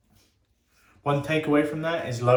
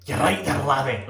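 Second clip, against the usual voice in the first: mock Scottish accent; 3 semitones higher, 4 dB louder than usual. Shaver imitating his friend's Scottish-Australian accent, in mock Scottish. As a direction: put on a broad, jokey Scottish accent. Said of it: "He has, like, a hybrid accent".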